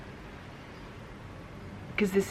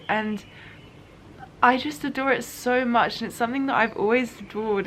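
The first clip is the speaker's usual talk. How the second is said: normal talking voice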